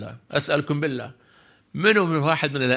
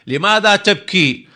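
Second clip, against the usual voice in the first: high, loud, drawn-out question; emphatic retold puzzlement; retold astonished question; 7 semitones higher, 6 dB louder, slower than usual. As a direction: ask it slowly with raised pitch, as a storyteller. Repeats question to weeping boy.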